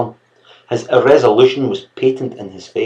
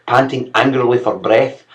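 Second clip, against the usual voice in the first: ordinary delivery, nothing marked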